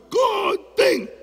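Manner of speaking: grito agudo y exagerado de director de cine; grito de corten. Retold or imitated. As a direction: grita corten como un director desesperado, muy agudo. Director grita corten otra vez. (grito agudo de director exasperado)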